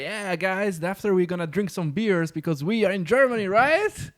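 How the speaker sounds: lightly voiced joking CTO. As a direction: imitate a speaker pitching a lame joke. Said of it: "he tried to make, like, kind of jokes".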